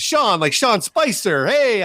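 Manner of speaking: high, drawn-out Italian-American guy voice; hammed-up Italian accent. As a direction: imitate an Italian pizza man, slow and high. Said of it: "it sounded like a Jerky Boys call".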